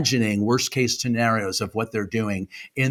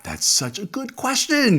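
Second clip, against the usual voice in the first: high, thin gush of praise; mock-amazed squeal; 9 semitones higher than usual. Guest praising the host's question, high-pitched mock amazement.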